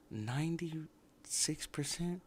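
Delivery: can you believe this number; incredulous question. Real, not acted. Vlogger staring at a review score, slow incredulous rise.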